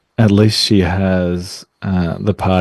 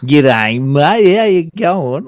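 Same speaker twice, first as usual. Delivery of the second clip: higher-pitched broad Aussie bogan drawl; thick bogan accent; 6 semitones higher, 4 dB louder, much slower than usual. Shown as a demonstration. Co-host demonstrating dirty talk in a thick bogan accent. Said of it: "in, like, a thick bogan".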